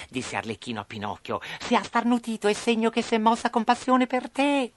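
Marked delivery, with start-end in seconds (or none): 1.6-4.7 high puppet voice, encouraging; hopeful Harlequin. Acted. Harlequin to Pinocchio after the sneeze, high and hopeful. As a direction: share good news in a little puppet voice.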